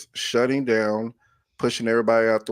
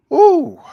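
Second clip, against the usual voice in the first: a sudden high, loud ooh as the feelings catch him; real emotion breaking through in one high gasp; emotional gasp; 11 dB louder than usual. Real, not acted. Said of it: "I was trying not to get emotional".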